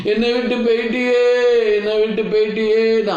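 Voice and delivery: mock funeral wail, stretching the last syllable; imitated wailing. Imitating relatives crying over their dead, mock wailing. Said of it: "அழுவாங்க".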